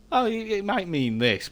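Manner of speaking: lightly voiced hopeful guess; musing guess. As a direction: play someone confidently guessing a word. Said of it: "you can sort of look at it and go".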